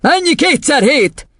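high, slow, forceful question; demanding headmistress. Acted. Headmistress quizzing a boy, high demand. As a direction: fire the test question up high.

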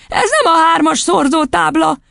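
put-on very high boyish objection; crying out. A narrator's reading. Boy contradicting the headmistress, crying out. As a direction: protest in a child's voice. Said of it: "kiáltott fel Vilmos".

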